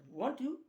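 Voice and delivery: usual speaking voice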